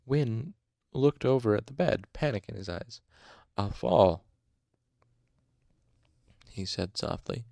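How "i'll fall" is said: understated horror, half embarrassed; horrified embarrassment. A narrator's reading. Huddled patient admits fear of the bed, mildly horrified and embarrassed. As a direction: confess the fear with a touch of shame. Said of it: "his voice somewhere between horror and em".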